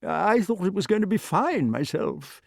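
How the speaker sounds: high, uneasy king; hesitant dotty king, pitched high; nervous. A narrator's reading. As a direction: venture it high, a little worried. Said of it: "looking about him nervously".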